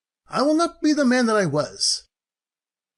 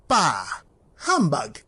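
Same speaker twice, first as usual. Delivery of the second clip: much lower, dismissive grumble; scornful; 10 semitones lower, slower than usual. Acted. Old Scrooge scoffing at Christmas, low scornful. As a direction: spit out the catchphrase with contempt.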